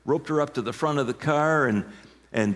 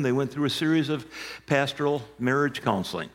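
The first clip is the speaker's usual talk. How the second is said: ordinary talk, nothing marked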